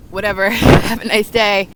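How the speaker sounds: light laughing dismissal; breezy brush-off. Retold or imitated. Telling the rude clerk to have a nice day, laughing brush-off. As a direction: shrug him off with a laugh.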